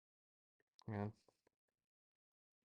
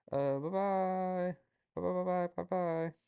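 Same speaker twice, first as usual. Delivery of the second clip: high, sing-song farewell; cutesy bye-bye; 9 semitones higher, 4 dB louder, slower than usual. Acted. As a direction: coo it like a baby goodbye. Cutesy sign-off.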